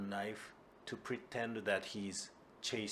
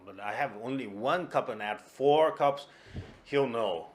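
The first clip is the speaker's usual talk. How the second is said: excited; 3 semitones higher, 9 dB louder than usual. Acted. Kuma scheming aloud, agitated.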